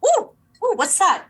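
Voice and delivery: high, perked-up curiosity; intrigued surprise. Retold or imitated. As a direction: act out suddenly hearing something intriguing. Acting out hearing the bridge.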